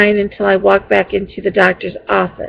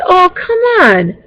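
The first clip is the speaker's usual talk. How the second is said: pitched up, exasperated oh come on; frustrated plea; 10 semitones higher than usual. Retold or imitated. Frustrated caller pleading with receptionist, high exasperated disbelief. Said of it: "I was frustrated".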